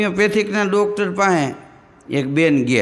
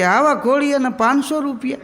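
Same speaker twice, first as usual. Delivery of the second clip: higher, laughing miser's grumble; a hint of a miserly father; mock miser's grumble; 7 semitones higher than usual. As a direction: grumble over the price like a stingy man. Miser grumbles about a cradle's price.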